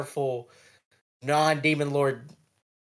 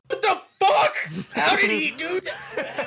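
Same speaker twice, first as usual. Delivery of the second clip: high, slow, laughing astonishment; shocked disbelief; 13 semitones higher than usual. Retold or imitated. Rimuru watching the dragon's attack, high laughing disbelief. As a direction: gape at the impossible move. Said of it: "Rimuru's like".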